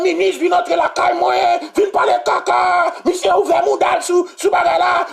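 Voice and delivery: hammed-up furious yelling; mock furious minister. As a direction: bellow at the police like the minister. Minister voiced raging at police.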